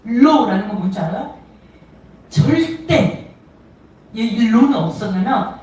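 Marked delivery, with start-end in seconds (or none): 2.3-3.3 절대 drawn out very slowly and higher; 절대 held long like a firm no; emphatic never. Shown as a demonstration. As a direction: stretch 절대 out long for strong denial. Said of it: "절대 강한 부정을 얘기해요".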